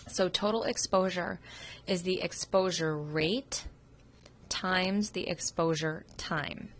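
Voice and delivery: slow and deliberate, each term set apart; slow formula dictation. Real, not acted. Teacher spelling out a formula for students, slow and deliberate. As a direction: lay the formula out word by word so it sticks.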